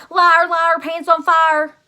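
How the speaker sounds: loud, high schoolyard taunt; hollered taunt. Acted. Jim hollering at Junie B from his desk, loud and high. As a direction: holler it across the room like a bratty kid.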